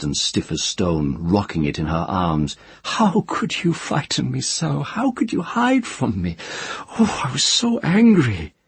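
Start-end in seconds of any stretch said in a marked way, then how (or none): 2.8-8.5 high, pleading and tender; a frightened woman coaxing a hidden child; high-voiced tender pleading to a child; tender frightened mother. Tenar rocking the child, weeping.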